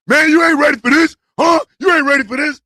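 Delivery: brash Texas. Retold or imitated. Texas bursts out the door taunting, loud and high. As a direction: yell it like a swaggering bully.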